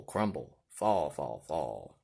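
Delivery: ordinary delivery, nothing marked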